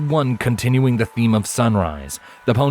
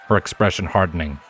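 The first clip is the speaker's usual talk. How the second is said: ordinary delivery, nothing marked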